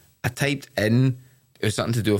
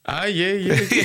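fake knowing nod; 9 semitones higher than usual. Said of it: "I just go".

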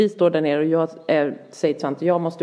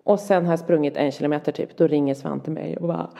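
usual speaking voice

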